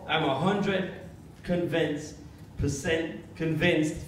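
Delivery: deliberate British accent, halting over the words. Shown as a demonstration. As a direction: put on a proper British accent. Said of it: "got to be a British accent".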